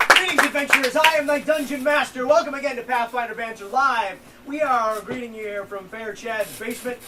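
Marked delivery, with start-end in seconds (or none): 0.1-4.2 grand, loud host voice; dungeon master announcer. Acted. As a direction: welcome the party with grandeur.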